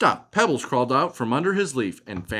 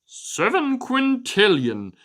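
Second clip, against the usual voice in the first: crab voice; 10 semitones higher than usual. Acted. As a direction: savor the big number like a crab. Crab character answers.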